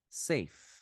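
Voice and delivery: "Safe" ending in just a breath of f; one-syllable English "Safe"; puffed final F. Shown as a demonstration. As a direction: let the f of "Safe" blow out with no vowel. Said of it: "só pra dar um soprinho também".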